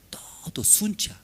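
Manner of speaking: breathy sudden whisper; whispered aside in a sermon. Acted. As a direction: drop to a secret whisper. Preacher whispers the suffering.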